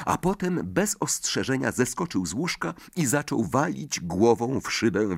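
ordinary delivery, nothing marked

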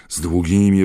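usual speaking voice

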